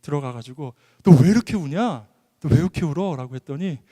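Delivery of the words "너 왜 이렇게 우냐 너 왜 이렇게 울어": loud, alarmed questioning of a crying son; mock-startled, forceful questions; startled mother. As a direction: imitate a mother taken aback. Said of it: "이 집사님이 너무 놀래가지고".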